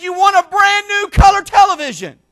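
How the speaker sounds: high, loud announcement of the prize; excited shout. Retold or imitated. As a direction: shout the big news.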